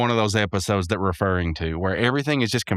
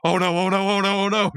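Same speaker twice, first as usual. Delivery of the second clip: high mock panic, oh no on repeat; Homer-style panic; 10 semitones higher than usual. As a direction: panic like Homer at a flip of a switch. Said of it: "Mordecai is so Homer".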